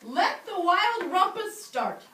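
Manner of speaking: loud, slow, high proclamation; excited cry. A narrator's reading. Teacher as Max starting the rumpus, excited cry. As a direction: announce the party with glee. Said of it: "cried Max".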